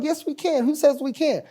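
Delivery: high-pitched mock arguing back; retold insistence. Writer argues back to the comedian, retold in a high voice.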